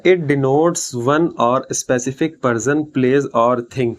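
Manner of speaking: very slow, every English word spaced out; slow dictation. Shown as a demonstration. Teacher dictating the definition to students, very slow and spaced. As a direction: read it out slowly so students can write it down.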